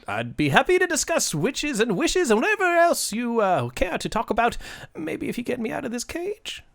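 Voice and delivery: high, wheedling leprechaun; bargaining leprechaun. Acted. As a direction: coax them in a high little voice.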